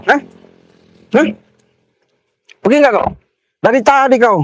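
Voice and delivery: high, impatient angry challenge; angry demand. Real, not acted. Driver fed up with the blocker, high angry demand. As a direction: demand that he move, voice pitched high.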